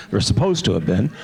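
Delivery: normal talking voice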